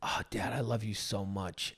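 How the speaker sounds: hushed, affectionate mimicry; tender child quote. Retelling son's tender bedtime words.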